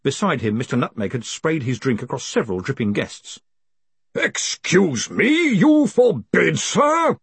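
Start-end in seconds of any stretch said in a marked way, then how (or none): 4.1-7.2 slow, loud, incredulous outrage; thunderous indignation, pitched up; sudden fury. A narrator's reading. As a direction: draw out each word as a furious challenge. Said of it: "in sudden fury".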